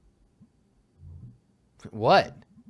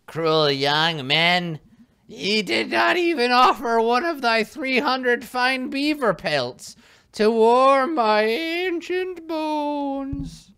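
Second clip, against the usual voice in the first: put-on old hag; 11 dB louder, slower than usual. Witch part.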